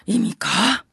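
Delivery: normal talking voice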